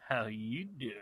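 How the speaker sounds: jokey character come-on, breaking into laughter after; Joey. Acted. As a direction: do the catchphrase in a put-on voice. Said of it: "let me do my Joey voice".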